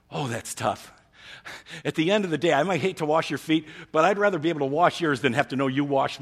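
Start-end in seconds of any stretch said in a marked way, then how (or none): none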